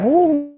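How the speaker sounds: loud high woo; high whoop. Real, not acted. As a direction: let out a sudden whoop. Sudden cheer.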